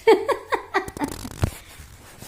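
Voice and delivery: high, loud peal of laughter, falling away; real laugh. Real, not acted. Vlogger telling viewers she must buy a mirror, laughing. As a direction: burst out laughing high, then let it fade.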